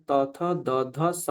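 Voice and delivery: ত, থ, দ, ধ, স with the tongue at the teeth; dental pronunciation. Shown as a demonstration. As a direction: sound out ত, থ, দ, ধ and a dental স. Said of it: "দন্তবর্ণ এটা যেহেতু". (দন্ত্য উচ্চারণ)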